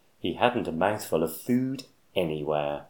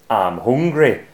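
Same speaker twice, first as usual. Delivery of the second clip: cried out; 7 dB louder than usual. Acted. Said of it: "cried Cyril".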